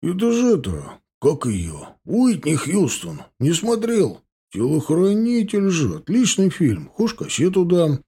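high, drawn-out goofy enthusiasm; eager crook. A narrator's reading. Лёня keenly recommends film to grumbling partner.

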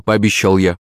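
ordinary delivery, nothing marked

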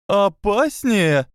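high, slow gasped question; gasping disbelief. Acted. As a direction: gasp the word in shock. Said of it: "ахнула Минерва".

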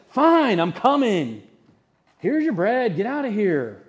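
loud, exasperated give-in; fed-up neighbor handing over bread; grudging annoyed reply. Acted. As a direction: snap it out like you want him gone.

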